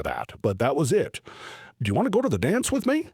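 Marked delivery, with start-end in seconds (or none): 1.8-3.1 nervous ask. Retold or imitated. Reenacting his nervous dance invitation.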